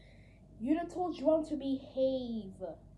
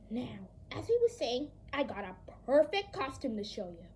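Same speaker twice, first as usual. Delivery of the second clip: ordinary talk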